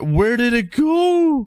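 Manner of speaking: high, very slow wailing whine; anguished whine. Real, not acted. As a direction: stretch every word in despair. Trade vanished after all that work.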